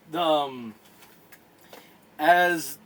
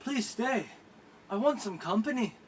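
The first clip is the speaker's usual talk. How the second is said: higher, softer plea; asking her to stay; 6 semitones higher, 6 dB quieter than usual. Acted. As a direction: coax her to sit with you. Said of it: "you go ahead and go, say".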